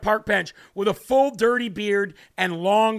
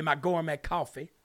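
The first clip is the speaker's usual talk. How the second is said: ordinary talk, nothing marked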